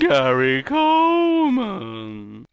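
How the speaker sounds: high, very slow ghostly moan. Acted. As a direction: drag the name out like a ghost. [name] voices the ghost again.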